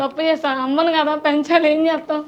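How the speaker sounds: usual speaking voice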